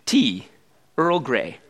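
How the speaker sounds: light attempt at an English accent, low. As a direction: imitate a starship captain's English accent, word by word. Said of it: "not my very good English accent".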